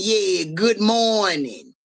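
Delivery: long drawn-out mock call; mock holler. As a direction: holler it slowly, stretching out the words. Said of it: "they were hollering".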